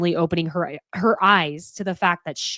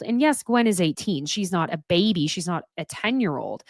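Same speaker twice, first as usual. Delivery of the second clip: ordinary talk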